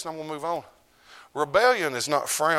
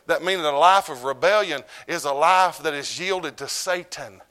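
preaching at full force; 4 semitones higher, 5 dB louder than usual. Acted. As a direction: hammer it home to the church.

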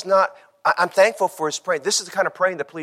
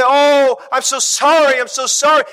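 retold wail, raised and high; imitated crying out; 8 semitones higher, 7 dB louder than usual. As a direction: imitate a grieving widow's loud lament. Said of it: "she'd cry out like a banshee".

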